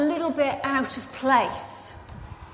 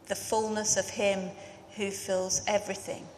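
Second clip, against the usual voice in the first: scripture reading; 2 semitones lower, 5 dB quieter than usual. Acted. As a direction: read the passage with solemn weight. Reads scripture before the sermon.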